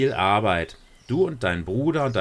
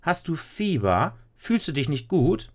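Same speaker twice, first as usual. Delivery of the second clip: astonished mother; 8 semitones higher than usual. Acted. Mother astonished at helpful daughter.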